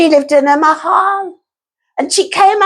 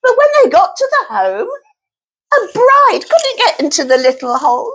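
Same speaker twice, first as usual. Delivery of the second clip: plain reading voice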